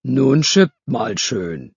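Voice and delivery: slow, deep commanding Petrus voice; a lightly voiced saint giving orders slowly; slow booming heavenly order. A narrator's reading. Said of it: "mit seiner tiefen Stimme gesagt".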